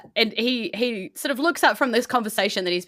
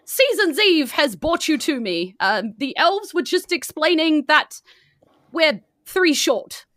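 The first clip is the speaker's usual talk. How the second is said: put-on higher, warm praising old man; jolly Santa; 8 semitones higher, 4 dB louder than usual. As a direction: praise the helper kindly, high and slow at first. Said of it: "and is like".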